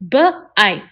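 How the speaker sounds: B-ike split into onset and long vowel; segmented. Shown as a demonstration. Teacher sounding out B-ike for the class. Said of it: "Say it out loud with me".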